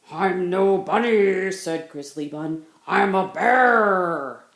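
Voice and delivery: slow, very loud burly voice; big bear voice. Acted. Grizzly Bun booming that she is a bear. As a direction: plant your feet and boom.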